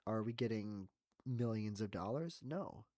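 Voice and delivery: ordinary talk, nothing marked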